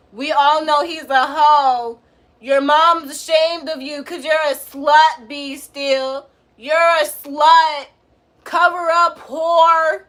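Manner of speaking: loud, slow insulting rant; heated roast. Real, not acted. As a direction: yell insults at the commenter. Roasting viewer B Steel angrily.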